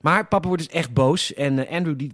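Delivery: usual speaking voice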